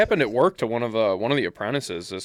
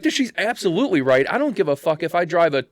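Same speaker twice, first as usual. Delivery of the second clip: loud, raised-pitch indignation; indignant rant; 5 semitones higher, 5 dB louder than usual. Retold or imitated. Friend sides with the Mustang owner against the sitter, indignant rant. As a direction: argue it forcefully, as if scolding the culprit.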